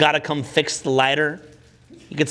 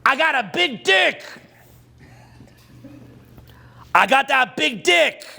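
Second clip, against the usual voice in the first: hammed-up brag; 6 semitones higher than usual. Shown as a demonstration. Teacher demonstrates the weaker pickup line, cocky mock brag. Sample boastful line, set against humble one.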